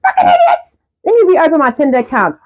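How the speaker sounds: high, sharp mock outburst at her ex. Irritated by her ex's message, a high mock outburst. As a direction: squeal it out, half joking. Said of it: "I'm like".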